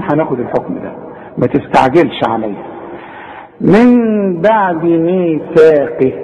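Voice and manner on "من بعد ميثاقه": measured Quran chant; slow recitation. Shown as a demonstration. Teacher recites the phrase slowly. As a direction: recite من بعد ميثاقه slowly with a light ta. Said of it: "ما اقفلش شفايفي".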